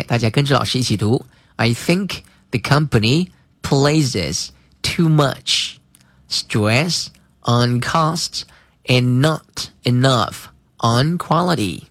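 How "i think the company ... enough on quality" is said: slow, clear model reading. Shown as a demonstration. Teacher reads slowly for students to repeat. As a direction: read it slowly for the class to follow. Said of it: "读慢一些".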